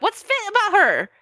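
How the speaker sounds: very high, loud disbelief; incredulous. Retold or imitated. Commenter reacting to praise of a thin star, incredulous. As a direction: squeal the question in disbelief. Mock disbelief at a described photo.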